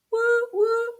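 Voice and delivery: high-pitched cheering woos; celebratory woo. Real, not acted. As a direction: cheer the class on with high whoops. Cheering after the rhythm exercise.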